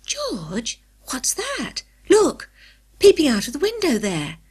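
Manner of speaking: hushed start jumping to a high urgent cry; alarmed, high-pitched discovery; startled. A narrator's reading. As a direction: get George's attention urgently. Anne startled, points out a face.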